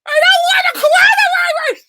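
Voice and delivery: angry yell. Acted. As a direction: shout it at the virus. Puppet yelling at the virus puppet.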